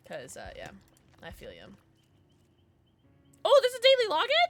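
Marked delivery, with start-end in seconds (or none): 3.4-4.5 loud, high-pitched exclamation; delighted surprise. Real, not acted. Streamer spotting a daily login, high surprised exclamation.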